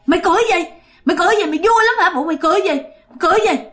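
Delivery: high, repeated accusing demand; acted indignant outburst; angry challenge. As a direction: confront him for laughing. Spirit slaps and challenges the laugher.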